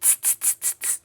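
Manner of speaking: soft unvoiced tsk-tsk; cheeky hissing monkeys; teasing monkeys. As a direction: monkeys tutting back at the peddler. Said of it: "the monkeys only shook their fingers at him and said".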